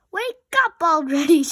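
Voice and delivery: loud high exasperated shout. A narrator's reading. Rabbit shouting at the snoring Robot, loud exasperated yell. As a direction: yell it in frustration. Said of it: "shouted Rabbit".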